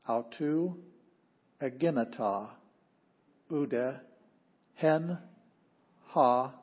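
slow choral reading of Greek, one word at a time; slow recitation, word by word. Shown as a demonstration. Teacher leading the class through John, slow recitation. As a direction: pause after every word so the class can repeat. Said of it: "let's say it together".

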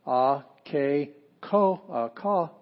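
"a, ke, ka" as separate beats with gaps, correcting a slip; syllable by syllable. Shown as a demonstration. Teacher sounding out five syllables, "a, ke, ka" spoken separately. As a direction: sound out each syllable of "a, ke, ka" firmly. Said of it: "five syllables in".

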